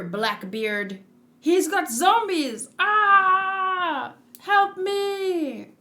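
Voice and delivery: hammed-up zombie scare; mock panic. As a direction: yelp it like a frightened sailor. Voicing a panicked game character.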